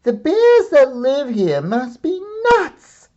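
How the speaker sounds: put-on high, loud, whiny little girl complaint; tired girl complaining loudly about the bears; exasperated girl. A narrator's reading. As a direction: wail it like a tired kid at the end of her rope. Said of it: "groaned the exhausted girl".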